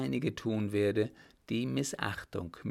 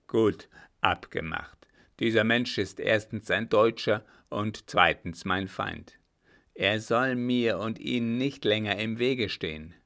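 captain's voice, firmly louder; a louder grim captain demanding a straight answer; loud stern captain; 6 dB louder than usual. Acted. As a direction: press him hard, like a gruff officer. Captain agrees to the murder.

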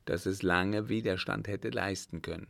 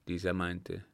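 usual speaking voice

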